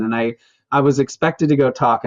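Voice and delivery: ordinary delivery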